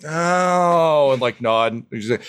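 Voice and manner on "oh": high, drawn-out understanding "oh"; collective realization. Acted. Gnomes all realize it is built for dwarves, dawning understanding. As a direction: let it dawn on the whole group.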